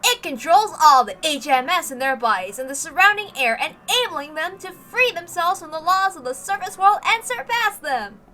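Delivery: loud, booming promo voiceover; trailer announcer. Acted. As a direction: sell it like an epic military trailer.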